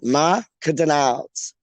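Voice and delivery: slow Macadonalds, like a French restaurant; posh pronunciation. Shown as a demonstration. Host rehearses the restaurant name, posh Macadonalds. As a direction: draw out Ma-ca-donalds. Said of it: "sounds like a proper, like, French restaurant".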